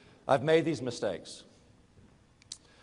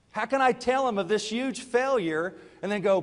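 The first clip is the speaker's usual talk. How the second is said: ordinary talk, nothing marked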